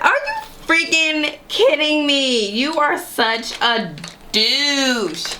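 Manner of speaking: very high, indignant exclaim, then slow; high appalled outburst; outraged shock. Retold or imitated. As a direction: shriek in disbelief, then drag out the insult. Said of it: "I was like".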